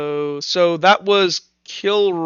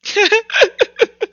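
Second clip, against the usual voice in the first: high-pitched, stuttering giggle; real laughing fit; 3 dB louder than usual. Real, not acted. Game master cracking up at the table, real laughter. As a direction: burst into a high, helpless giggle.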